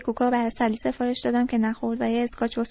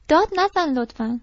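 exasperated plea; 4 semitones higher, 5 dB louder than usual. Acted. Sally objects to his raised voice.

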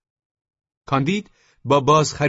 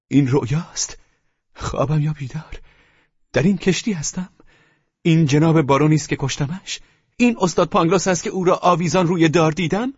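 raised pitch, building in volume and pace; amazed exclamation; 5 semitones higher, 4 dB louder than usual. A narrator's reading. Candide finds the men he thought dead, amazed exclamation. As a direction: pile up the disbelief, higher and louder.

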